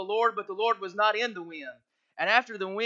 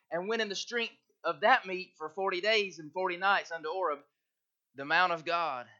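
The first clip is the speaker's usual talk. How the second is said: soft, measured Bible reading; hushed King James reading; scripture reading; 2 semitones lower, 4 dB quieter than usual. Acted. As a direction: read the verses gently to the church.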